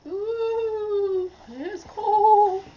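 very high, loud wailing ghost. Pretend. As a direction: wail it out like a spooky ghost. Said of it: "sound like a ghost".

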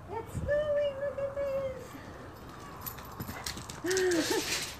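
high excited squeal. Real, not acted. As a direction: squeal it with delight. Excited snow announcement vlog.

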